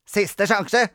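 high, loud warning; severe, bossy ultimatum; stern. A narrator's reading. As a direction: warn like a strict child teacher. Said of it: "sa Grete strengt".

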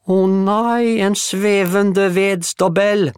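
very high, loud, slow whimper of fright. Acted. Juliette seeing a floating woodpile, whimpering in mock terror. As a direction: wail in hammed-up terror. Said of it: "klynket Juliette".

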